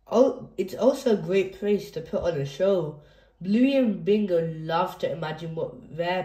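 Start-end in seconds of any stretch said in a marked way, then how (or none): none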